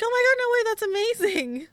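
high, loud retold gush of amazement; excited disbelief. Performer retelling their reaction to the award news, excited disbelief.

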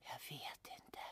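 barely a voiceless whisper; faint whisper. Retold or imitated. Exhausted woman whispering to her producer. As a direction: whisper with no voice left. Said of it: "jag får bara till en liten viskning".

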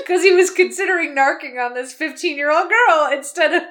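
amused, incredulous laugh in the voice. Real, not acted. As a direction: try to get it out through laughter. Cracking up at the character.

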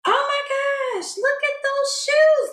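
high, excited gush; pretend delight. Imagined shopper at Macy's, gushing high over shoes. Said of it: "we're like".

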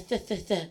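soft "th, th, th" with tongue between teeth; imitated lisp. Barista describing how the customer talked, imitated lisp. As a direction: lisp out "th, th, th" quietly. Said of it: "She had the lisp?".

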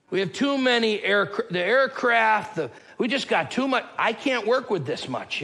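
high, exasperated put-on voice; mock complaint. Preacher mimicking someone turning down too many men, mock complaint. Mocking an absurd complaint about excess.

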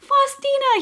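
very high, loud exclamation; delighted greeting. Retold or imitated. Priest recognises the little girl, imitated delighted greeting.

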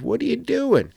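mock alarmed challenge. Bystander challenges disciples untying colt.

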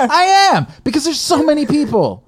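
high, loud mock exasperation; exasperated retelling. Retelling his reply to security, high mock exasperation. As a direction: whine it like you're fed up.

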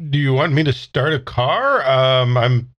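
ordinary delivery

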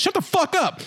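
high, sharp mock yell; retold snap. Gamer snapping at a nagging kid, high yell. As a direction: bark it back at the kid. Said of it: "And I'm like".

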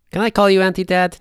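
high, fast and eager; excited. Acted. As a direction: blurt it out eagerly. GM as Kaz, excited question.

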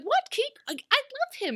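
high whiny mock plea, rising to very high; begging in a squeaky put-on voice; mock pleading Regina. Regina pleading with Rumpel, high mock whine. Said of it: "She's like".

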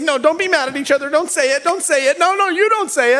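high, fast pleading voice; frantic mock peacekeeper; anxious peacekeeper.

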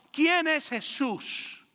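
very slow, deliberate asking; slowed-down final challenge; slow rhetorical question. Acted. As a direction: let ¿Quién es Jesús? hang word by word.

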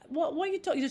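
spring back, wide open; Cockney Eliza. Shown as a demonstration. Eliza brushing off Higgins, Cockney and bright. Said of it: "She's like".

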